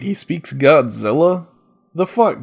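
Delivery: ordinary delivery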